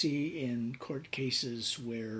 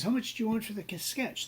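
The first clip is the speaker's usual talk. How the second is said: casual buyer; 9 semitones higher, 3 dB louder than usual. Retold or imitated. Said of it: "So he says".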